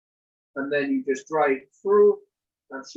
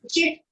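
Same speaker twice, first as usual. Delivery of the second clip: sneeze; 13 semitones higher, 3 dB louder than usual. Real, not acted. Sneezing fit.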